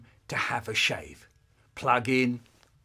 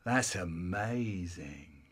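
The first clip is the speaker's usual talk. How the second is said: low, soft and very slow; hushed awe; 8 semitones lower, 7 dB quieter, much slower than usual. Acted. Boy admiring what he buried in the soap, awed. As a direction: marvel quietly, drawing it out.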